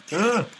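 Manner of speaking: loud high shriek of fright; startled scream. Real, not acted. Player ambushed by a spider, startled scream. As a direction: scream as something jumps out. Monster appears suddenly.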